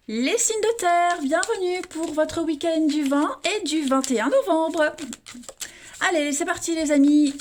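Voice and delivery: upbeat host intro; show opening welcome. Acted. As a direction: welcome the audience like a host, slowly. Opens the earth signs reading.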